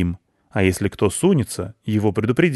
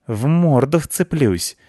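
warning cat; 7 semitones higher, 3 dB louder, slower than usual. A narrator's reading. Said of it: "предупредил Рыжопиус".

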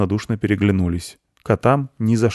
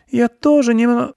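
put-on very high girl's voice, cut short; girl breaking off; 6 dB louder than usual. A narrator's reading. Girl tries the goat's phrase, high and breaking off. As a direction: start repeating, then stop mid-word. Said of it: "начала Аня и осеклась".

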